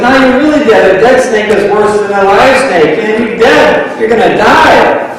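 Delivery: high voice, slowing on the last words; ominous taunt. Retold or imitated. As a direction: drag out the doom, you're gonna die. Natives warn dead snake worse.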